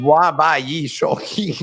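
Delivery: 滑板语速器 said slowly, syllable by syllable in Mandarin; Chinese pronunciation. Shown as a demonstration. Nick demonstrates the Chinese phrase to viewers. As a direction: read 滑板语速器 slowly and carefully. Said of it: "That's actually how you say it".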